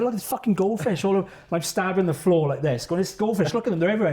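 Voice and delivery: pleading with a friend to see what he sees; insistent hallucination. Retold or imitated. Insisting goldfish are there.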